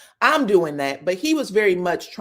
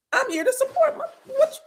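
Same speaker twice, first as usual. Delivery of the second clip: indignant retort. Retold or imitated. Glenn indignantly defending himself.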